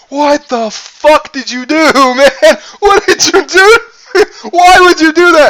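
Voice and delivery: high, loud wailing protest; mock freaked-out buddy. Imitating Brandon after the scare, high mock-crying yell. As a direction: imitate a pranked friend wailing in disbelief. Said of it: "He's like".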